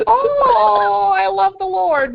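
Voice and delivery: high, wailing mock-devout cry; mock piety. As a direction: put on a loud, drawn-out holy-woman wail. Mocking a woman's pious claim.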